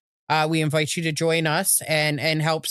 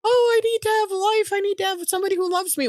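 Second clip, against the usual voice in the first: mocking whine. Mocking a sugar daddy's plea.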